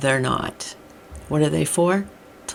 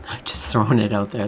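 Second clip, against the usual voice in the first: whispered aside; 5 semitones lower, 3 dB louder than usual. Real, not acted. Whispered aside mid-rant.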